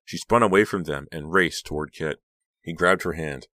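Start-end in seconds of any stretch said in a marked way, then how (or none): none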